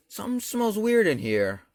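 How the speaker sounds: high-pitched mock suspicion; suspicious mom. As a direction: imitate mom dropping a pointed hint, pitched high. Suspicious mom sniffing around.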